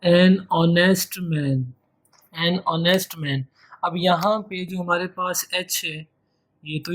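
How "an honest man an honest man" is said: an honest man read very slowly, each word clear; slow model reading. Shown as a demonstration. Teacher to students, slow clear model phrase. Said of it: "एक और example देखिएगा".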